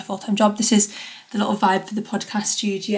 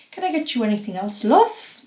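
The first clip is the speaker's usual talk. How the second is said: hammed-up Bristol shop worker asking with a lilting love; bad Bristol accent. Chatting to listeners at the airport, a bad mock Bristol accent. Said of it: "That was a really bad Bristol accent".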